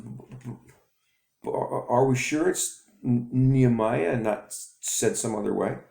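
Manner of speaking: put-on stuttering boy. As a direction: stammer out the question. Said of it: "he stutters".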